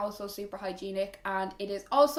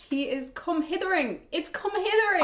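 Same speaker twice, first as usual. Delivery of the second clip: delighted; 8 semitones higher than usual. Real, not acted. Delighted watching the toy.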